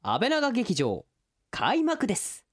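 announcer title call. Acted.

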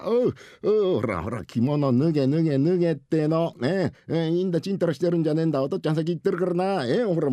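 impatient urging. Acted. Father hurries son to undress, impatient. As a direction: hustle the boy along noisily.

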